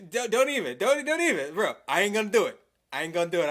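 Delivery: snapping at the chat, fired up; heated outburst. Real, not acted. Angry at chat, refusing loudly. Worked up about being called out.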